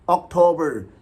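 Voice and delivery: अक्टूबर as ok-TOH-ber; English pronunciation. Shown as a demonstration. Teacher repeating the correct form, अक्टूबर as ok-TOH-ber. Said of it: "इसको English में ऑक्ट करके पढ़ना है".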